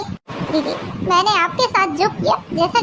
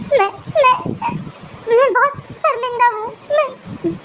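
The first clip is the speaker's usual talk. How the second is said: ashamed, breaking down; 4 semitones higher than usual. Acted. Daughter-in-law confessing to her in-laws, choked up. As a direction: voice catching on broken words.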